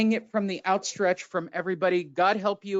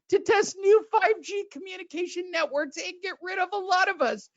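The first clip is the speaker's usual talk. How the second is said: mock incredulous; 13 semitones higher than usual. Real, not acted. Mocking the conspiracy claim.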